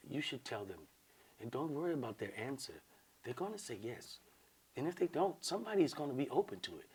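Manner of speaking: hushed, reassuring retelling; soft-spoken woman. Retold or imitated. Woman reassuring him about the women's answer, real soft. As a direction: advise him very softly. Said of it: "Real soft".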